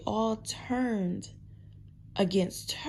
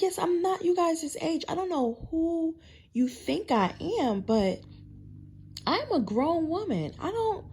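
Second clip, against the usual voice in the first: higher-pitched, insistent self-quote; indignant retort; 8 semitones higher than usual. Retold or imitated. Grown woman correcting teenagers about her age, indignant. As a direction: re-enact setting them straight, voice raised in pitch.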